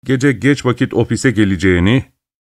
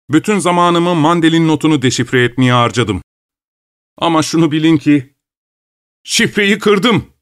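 triumphant cry; 5 semitones higher than usual. A narrator's reading. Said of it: "diye haykırdı zaferle".

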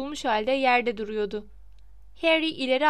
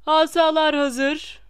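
loud, slow cry of a teacher starting a duel; shouted command; 3 semitones higher, 8 dB louder, slower than usual. A narrator's reading. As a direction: call it out slowly across the hall. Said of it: "diye haykırdı Lockhart". (yüksek sesle, yavaş bir haykırış)

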